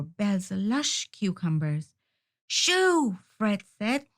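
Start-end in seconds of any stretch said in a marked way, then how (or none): 2.5-3.2 high sharp shoo. A narrator's reading. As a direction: chase the hen away. Fred shooing turkeys.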